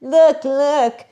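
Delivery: superior, pointedly slow mock scolding; mock martyr superiority. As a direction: imitate someone lecturing from above. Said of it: "doing martyr superior stuff".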